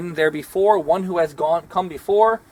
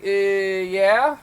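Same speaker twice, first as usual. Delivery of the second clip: drawn-out hesitant Uh, yeah with a rising end; unsure yeah; 3 semitones higher than usual. Shown as a demonstration. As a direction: say yeah like you mean no. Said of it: "That don't sound very positive".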